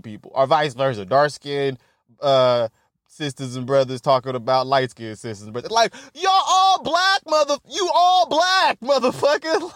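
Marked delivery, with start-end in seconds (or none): 5.7-9.7 laughing exclamation. Retold or imitated. Mocking exasperation, laughing, high.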